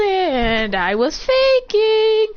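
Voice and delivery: high, drawn-out gotcha; mocking reveal. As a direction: stretch it out high as a gloating reveal. Acted reveal after tail pull.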